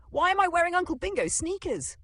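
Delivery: high, loud squawk; Christine. A narrator's reading. Grandma in a swapped voice, squawking indignantly. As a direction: squawk it in shrieky disbelief. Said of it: "Grandma squawked in Christine's voice".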